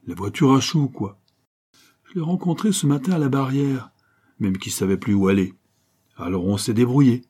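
lively slangy urchin bragging to a grown-up; cocky street kid. Acted. Street kid explains proudly to landlady.

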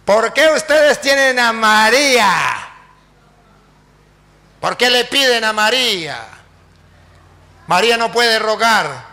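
loud, drawn-out preacher's demand; accusing challenge. Acted. Pastor challenges Catholics about praying to Mary, loudly accusing.

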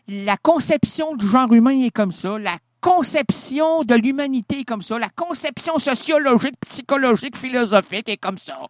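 plus haut et plus fort, martelé, tirade qui s'emballe; tirade exaltée. Acted. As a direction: enfoncer chaque « comme ça » avec fougue. Rising rant, repeated phrase. (tirade enflammée, voix haute et forte)

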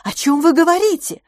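very high, loud bewildered wail; exasperated, high-pitched begging; desperate plea. A narrator's reading. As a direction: implore them, voice shooting up. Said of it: "взмолилась несчастная мисс Петтигрю".

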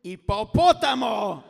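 very loud, slow shouted insult; sudden insult yell. Retold or imitated. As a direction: bark it at your wife out of nowhere. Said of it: "se le cruzaron los pajaritos y dice".

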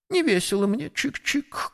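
high, softly fading little bird lament; sad sparrow. A narrator's reading. Sparrow admits to the sick horse he's cheerless, sad chirp. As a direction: chirp it sadly, high and small. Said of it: "грустно сказал воробей".

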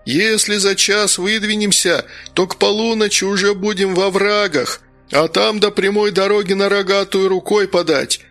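shouting over wind. A narrator's reading. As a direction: yell to be heard over a storm. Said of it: "прокричал, перекрикивая усиливающийся ветер".

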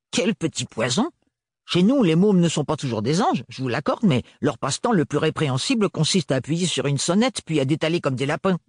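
a hint of an outraged old aunt; lightly voiced Roseline. A narrator's reading. Tante Roseline compares the child to village kids, outraged. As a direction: scold like a provincial aunt.

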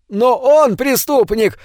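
high, slow insistent shout; shouted protest. A narrator's reading. Angmark shouting to the gnome about the criminal, high and slow. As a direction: shout it to sway the crowd. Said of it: "закричал Ангмарк".

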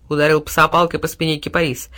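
ordinary delivery, nothing marked

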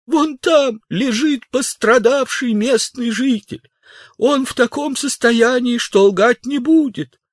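very high, drawn-out pleading; мольба могильщика. Acted. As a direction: plead with the officer in a strained high cry. Gravedigger pleads after crying out. (умоляющий, протяжный высокий крик)

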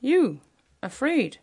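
put-on can't believe the brave boy is scared; in wonderment. A narrator's reading. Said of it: "in wonderment".

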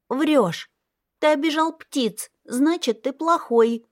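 put-on high child voice, blaming; accusing little girl. A narrator's reading. Little girl calls her brother a liar, high and accusing. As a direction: point the finger like a hurt child.

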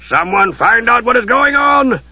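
roaring. Acted. Lord roaring orders at his knights. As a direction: bellow the order across the hall. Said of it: "Harry Aiken roared".

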